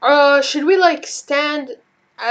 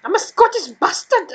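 a weak, higher mock Scottish accent; bad Scottish accent; 9 semitones higher, 3 dB louder than usual. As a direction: try a Scottish accent and miss. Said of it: "That was terrible".

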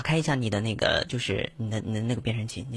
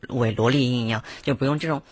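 ordinary talk, nothing marked

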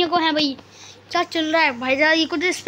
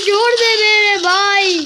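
slow, higher, louder begging; wailing plea; 4 semitones higher, 4 dB louder than usual. Real, not acted. Gamer begging the flower to stop throwing him, loud wailing plea. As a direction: drag out the plea.

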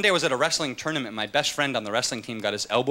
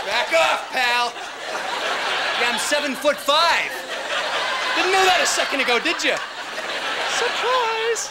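high, strained mock threat; fake tall tough guy; 12 semitones higher, slower than usual. Skinny guy bluffing a bully with his height, mock threat. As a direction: push it high like stretching up taller. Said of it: "try and accentuate my tallness".

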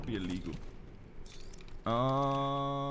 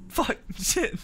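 high, short mock curses; reenacted panicked swearing; 10 semitones higher, slower than usual. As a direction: replay your own flustered cursing, laughing. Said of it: "I'm like".

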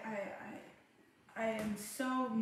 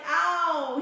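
loud, higher yelp of pain, played for laughs; mock pained cry; 6 semitones higher, 11 dB louder than usual. Mother imitating her son at the third shot, yelping. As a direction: yelp like a kid getting a shot. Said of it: "then the third one came".

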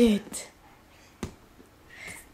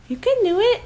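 higher, coaxing cheer; high encouraging; 7 semitones higher than usual. Real, not acted. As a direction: cheer the dog on, pitched up. Encouraging dog to fetch.

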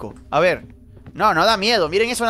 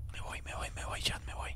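whispering; 21 dB quieter than usual. Real, not acted. Whispering while hiding in game.

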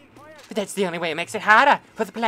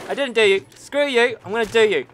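usual speaking voice

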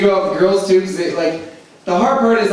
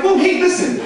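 mock eager perk-up; 13 semitones higher than usual. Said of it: "I'm like".

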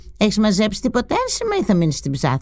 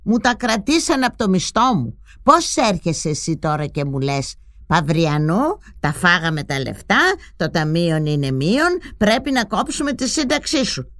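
building indignant rant, rising higher toward the end; 9 semitones higher, 4 dB quieter than usual. Acted. Mother rants at the prime minister, building anger. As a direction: scold an invisible minister, heating up. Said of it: "άρχιζε να φωνάζει".

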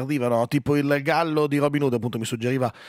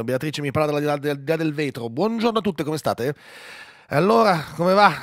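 ordinary talk

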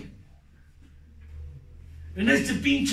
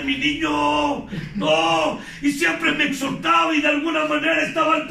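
loud, raised testimony pitched high and pushed hard; preaching at full force; 8 semitones higher, 4 dB louder than usual. Acted. Speaker confessing to his group, preaching at full force. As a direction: pour it all out from the podium.

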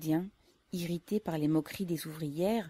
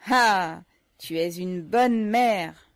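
loud, slow burst of delight; can't hold back his joy; young worker bursting out at the mother; excited cry; 2 semitones higher, 10 dB louder, much slower than usual. Acted. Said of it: "Ivan s'écria".

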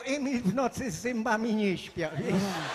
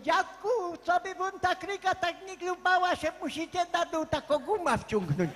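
put-on a kid's cheeky advice; 7 semitones higher than usual. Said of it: "Powiedział mi".

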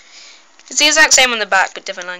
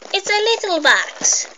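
losing my mind; 10 semitones higher than usual. Real, not acted. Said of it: "If I sound a bit crazy".

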